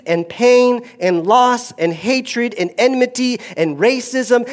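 high, loud, ringing proclamation; full-force preaching. Acted. Pastor to congregation, proclaiming God's rebuke loudly.

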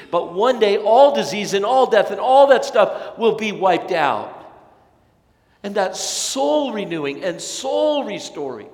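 loud, high, ringing sermon build; fervent preacher's climb; full-force preaching. Acted. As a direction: preach it to the back pews.

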